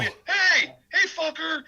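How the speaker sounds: high, loud heckling call; imitating a downtown heckler; mock street heckle. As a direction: shout it like a rowdy street guy.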